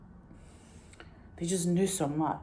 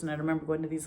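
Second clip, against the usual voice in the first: normal talking voice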